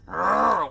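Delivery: loud growl from the back of the throat; Keith David growl. Retold or imitated. Fan mimicking an actor getting angry, throaty growl. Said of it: "growl in the back of his throat".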